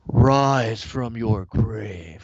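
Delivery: slow, deep booming game voice; game announcer voice. Retold or imitated. Gamer recalling the Altered Beast intro, slow booming announcer. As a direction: intone it like an old arcade announcer.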